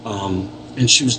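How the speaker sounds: normal talking voice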